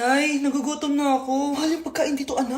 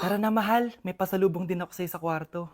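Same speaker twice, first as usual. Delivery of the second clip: much lower, softer, secretive male voice; impostor dad; 10 semitones lower, 8 dB quieter than usual. Acted. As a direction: lure her off quietly in a deep voice. Fake father to wife, bedtime. (mababa at mahinang boses ng nagpapanggap na tatay)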